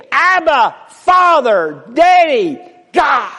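high, loud, pleading cry to the Father; crying out to God. Real, not acted. Preacher crying out to God before the congregation, loud and high. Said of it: "a spirit that cries".

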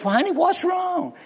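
high-pitched, startled concern; mock alarm. Husband to his suddenly crying wife, alarmed.

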